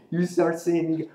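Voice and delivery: ordinary talk, nothing marked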